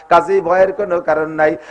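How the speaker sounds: normal talking voice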